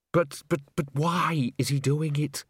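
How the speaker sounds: stammering, slow plaintive wail; mild wail. A narrator's reading. Bucket asking Salzella about the killer, mildly wailing. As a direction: wail it a little, stumbling at the start. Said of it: "wailed Bucket".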